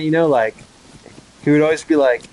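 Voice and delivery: ordinary delivery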